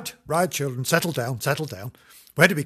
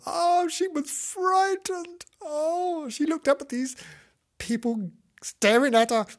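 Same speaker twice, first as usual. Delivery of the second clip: hammed-up fright. A narrator's reading. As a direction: overplay the puppy's alarm, high-pitched. Narrating the frightened puppy's view.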